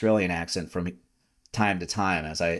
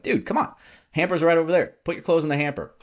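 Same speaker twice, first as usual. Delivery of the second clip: exasperated dad; 8 semitones higher, faster than usual. Retold or imitated. Scolding son over clothes.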